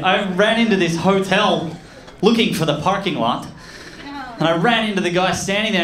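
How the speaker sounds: usual speaking voice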